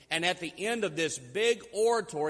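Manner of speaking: normal talking voice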